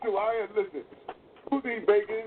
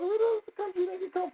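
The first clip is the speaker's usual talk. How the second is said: pitched way up, mocking disbelief; 12 semitones higher than usual. Retelling to his cohost his shock at a Hartford guy's accent, in high mock disbelief. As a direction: play up your own shock at the accent.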